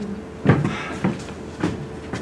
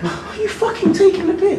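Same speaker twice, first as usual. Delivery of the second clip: exasperated; 10 semitones higher than usual. Acted. Son exasperated.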